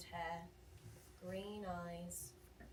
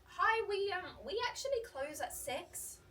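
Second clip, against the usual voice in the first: polite service voice; 11 semitones higher, 6 dB louder than usual. Retold or imitated. Barista politely tells customer closing.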